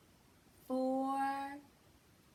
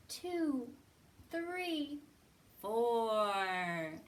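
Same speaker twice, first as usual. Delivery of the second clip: plain reading voice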